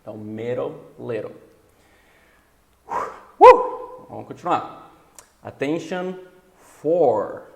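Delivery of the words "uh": sudden loud high whoop. Real, not acted. Teacher rallies before continuing, a loud high whoop. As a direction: let out a sharp high "woo".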